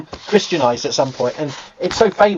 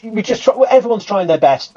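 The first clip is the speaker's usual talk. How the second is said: ordinary delivery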